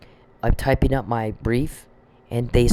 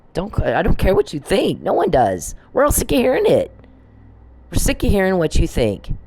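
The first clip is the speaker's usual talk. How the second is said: furious rant; 7 semitones higher than usual. Real, not acted. Telling off the man who threatened her, furious. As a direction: let it burst out of you at him.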